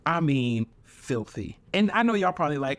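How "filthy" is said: soft dropped emphasis. Acted. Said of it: "repeated emphasis".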